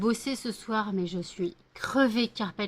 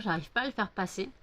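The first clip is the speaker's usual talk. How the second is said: ordinary talk, nothing marked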